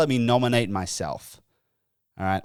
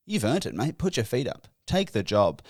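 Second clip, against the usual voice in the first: softer, lightly voiced encouraging listener; imagined supportive listener; 5 dB quieter than usual. Imagined listener urging the host to take the job, lightly voiced encouragement. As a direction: gently egg him on, as an imagined fan. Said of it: "now you're thinking".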